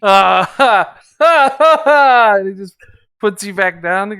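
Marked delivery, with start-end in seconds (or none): 0.0-2.3 loud, high hearty ha ha ha; big jolly brute laughter; booming ogre laugh. Acted. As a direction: laugh like a delighted giant.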